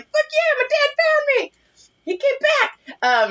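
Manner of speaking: squeaky, very high cartoon baby. Baby voiced by the speaker, squeaky high cheer. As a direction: voice the baby like a tiny cheering fan.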